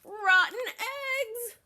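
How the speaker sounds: hammed-up goat boasting; Mister Goat voice. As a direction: drawl it out proudly. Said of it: "Mister Goat said".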